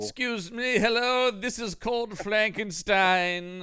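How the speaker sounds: high, drawn-out mock movie-star host; James Mason. Spoofing the film's intro, hammed-up James Mason. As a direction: put on a hammy, slow actor's voice. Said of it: "you didn't get the James Mason intro".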